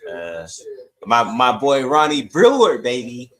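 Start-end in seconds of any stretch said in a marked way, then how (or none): none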